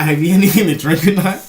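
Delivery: high, loud quote through laughter; laughing cop quote. Retold or imitated. Retelling the cop's question, laughing loudly.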